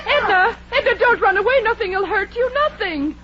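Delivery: high, pleading calls; calling after her. Acted. Kay calls after fleeing Edna in high, pleading calls. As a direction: call her back before she runs.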